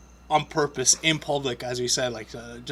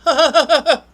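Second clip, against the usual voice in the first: high, loud forced laugh; much higher, louder hollow laughing; mock fake laughter; 13 semitones higher, 13 dB louder than usual. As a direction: ham up fake polite laughter. Imitating fake crowd laughter.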